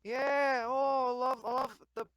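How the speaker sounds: high, loud giddy party bro; over-excited party dude. Acted. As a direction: near bursting with excitement at the party. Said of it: "You sound like you're, like, milliseconds away".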